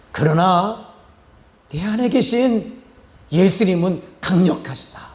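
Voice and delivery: re-enacted deliverance prayer, loud at its peak; exorcism command. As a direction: rebuke Satan with building force, high-pitched and firm. Reenacts his exorcism command to Satan.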